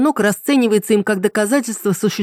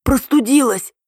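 higher-pitched, hoarse sickbed croak; feigned croak; 6 semitones higher, slower than usual. A narrator's reading. Ритка lies to Мельников about a cold, croaking. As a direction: croak it out as if ill. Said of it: "а затем прохрипела".

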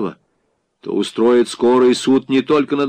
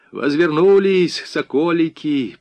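high, slow, forced sweetness; almost cry out a fawning welcome; sugary; 6 semitones higher, 3 dB louder, slower than usual. A narrator's reading. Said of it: "презирая себя за слащавость в голосе". (слащаво, почти криком)

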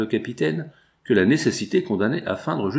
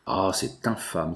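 slow, hushed outrage with an unfamiliar timbre; indignant exclamation; 3 semitones lower, 4 dB quieter, slower than usual. A narrator's reading. Captain reading the accusation, slow feigned outrage. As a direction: feign shock at the letter, drawing it out. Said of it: "s'écria-t-il".

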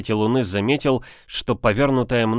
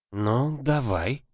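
put-on interested old witch; 3 semitones higher, 4 dB quieter, much slower than usual. A narrator's reading. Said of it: "Заинтересовалась колдунья".

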